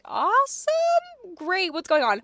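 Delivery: long, high drawn-out cheer; mock enthusiasm. Tenant reacting as landlord gets dragged away in cuffs, mock enthusiasm. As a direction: stretch it out high, then rattle off the rest.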